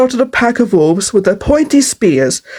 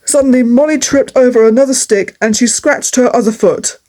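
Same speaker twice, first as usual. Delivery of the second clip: usual speaking voice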